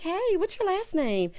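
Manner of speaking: high, perky mock-bride voice; imitated chatty bride. Bride quizzing her new groom, mock perky. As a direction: play a bride making eager small talk. Voicing the tipsy bride flirting.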